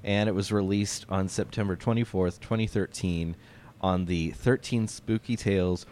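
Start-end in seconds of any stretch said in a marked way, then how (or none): none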